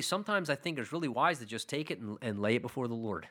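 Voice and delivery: ordinary talk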